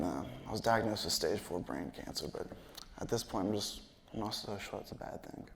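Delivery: mildly acted, soft and downcast; dejected confiding. Retold customer confiding misfortunes.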